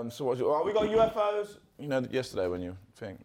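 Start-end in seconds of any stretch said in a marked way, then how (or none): 0.5-1.6 higher-pitched mock announcement; offhand retold announcement. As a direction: announce it like a passing remark. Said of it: "that's basically how they announced it".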